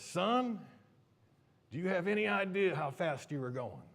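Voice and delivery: stern patrolman. Retold or imitated. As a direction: confront the kid like a highway trooper. Imitated patrolman confronts the driver.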